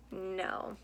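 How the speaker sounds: ordinary delivery, nothing marked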